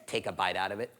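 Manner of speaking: usual speaking voice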